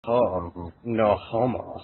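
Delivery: deep, robotic machine voice. Acted. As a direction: speak like a cold machine. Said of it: "I was a machine that said".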